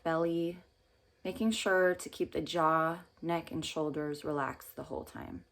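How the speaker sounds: calm meditation guide with long pauses; unhurried phrases, leaving room to breathe between them; guided meditation. Real, not acted.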